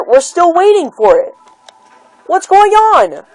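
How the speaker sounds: frustrated voice breaking; voice cracking. Real, not acted. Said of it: "sorry if I voice crack".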